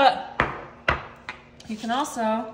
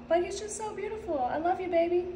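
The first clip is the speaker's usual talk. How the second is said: tender cooing; 5 semitones higher, 5 dB quieter than usual. Real, not acted. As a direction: coo softly to your little one. Coos lovingly to her child.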